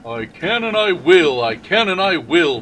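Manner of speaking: grind it out with resolve; determined puffing. Acted. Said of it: "puffed James".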